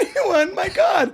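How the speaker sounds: high, hammed-up exasperation; mock desperate plea. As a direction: plead to an uncaring room, playfully. Acting out helper's desperate plea.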